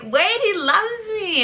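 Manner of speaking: high, slow dreamy plea; a dreamy, naive protest; lovestruck naive self. Pretend. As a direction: sigh it out like a love-blind girl.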